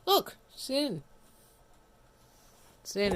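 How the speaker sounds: excited. Real, not acted. Reboot completes, streamer cheers.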